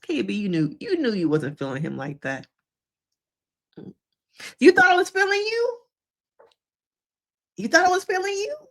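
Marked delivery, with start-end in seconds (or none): 4.6-8.6 mocking disbelief. Mocking the bride's reaction.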